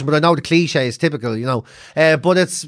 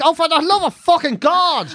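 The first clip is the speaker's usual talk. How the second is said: exasperated; 7 semitones higher than usual. Retold or imitated. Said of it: "I'm like".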